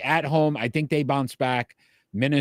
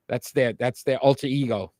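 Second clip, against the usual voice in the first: ordinary talk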